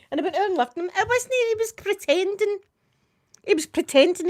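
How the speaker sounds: high, amused, laughing through the words; giggling as she admits she was fooled. Real, not acted. Grandma laughing about the grandson's prank.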